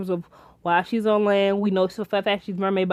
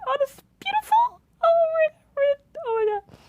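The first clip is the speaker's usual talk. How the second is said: swooning. Retold or imitated. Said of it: "I'm like".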